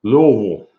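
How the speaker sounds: Louisville swallowed into a round "LUH-vul"; exaggerated local "Luh-vul" for Louisville; mouthful of marbles. Shown as a demonstration. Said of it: "like you got a mouthful of marbles".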